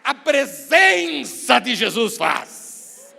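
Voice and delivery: loud, impassioned preacher's cry with long-held words; preaching at full force. Real, not acted. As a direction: proclaim it to the whole congregation. (pregação fervorosa, a plenos pulmões)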